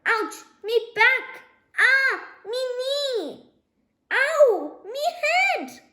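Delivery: high, loud pained yelps; howling in pain. A narrator's reading. Dad howling over his bruises after the crash. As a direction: wail each hurt part comically.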